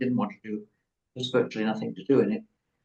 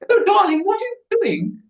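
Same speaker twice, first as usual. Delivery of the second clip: very high, fluting mother voice; hammed-up motherly squeal, way up in pitch; exaggerated fussing mother; 6 dB louder than usual. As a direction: coo it absurdly high, like an interrupting mother. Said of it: "Didn't speak like that".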